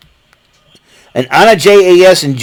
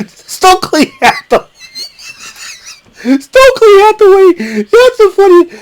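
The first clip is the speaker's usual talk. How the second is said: laughing. Real, not acted. Laughing at Stokely's look.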